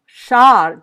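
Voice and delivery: "char" loud and emphatic; isolated, loud "char"; stressed first syllable. Shown as a demonstration. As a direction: punch the opening syllable char. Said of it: "But if I hear char".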